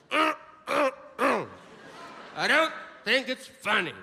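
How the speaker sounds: stammering prude, hammed up; offended audience member. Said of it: "you're like".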